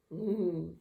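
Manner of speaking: ordinary delivery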